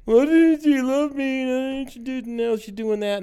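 high, slow, whining sulk; mock whiny self-pity. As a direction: whine like a heartbroken sad sack, mockingly. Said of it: "Rather than being wallowing in the".

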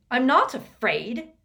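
put-on boy snarling denial at headmaster; snarled. A narrator's reading. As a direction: spit it out in defiance. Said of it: "snarled Malfoy".

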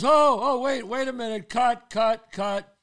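high, loud, frantic mock call to stop; alarmed cut. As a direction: shout cut like a panicked biker stopping the take. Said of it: "He goes".

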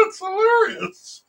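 high laughing squeal. Real, not acted. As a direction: laugh through the words. Laughing at the picture.

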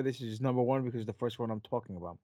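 normal talking voice

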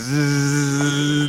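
imitated long vibrator buzz; buzzing noise. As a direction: hold a steady buzzing zzz.

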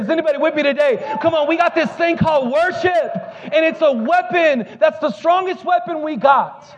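high-pitched, impassioned preacher delivery; rally the congregation with rising fervor; preaching. Real, not acted. Pastor urges church to wage war with worship, preaching.

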